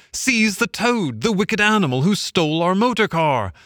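high, outraged cry of the party; the party turning on Toad; angry cry. A narrator's reading. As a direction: cry out to grab him. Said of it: "they cried".

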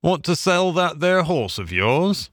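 a careless horse-dealer's offer, pitched high. A narrator's reading. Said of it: "remarked in a careless way".

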